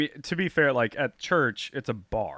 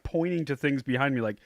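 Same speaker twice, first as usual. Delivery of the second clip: ordinary delivery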